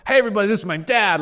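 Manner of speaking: bouncy stage announcement; upbeat presenter introducing his dad; excited host intro. Retold or imitated.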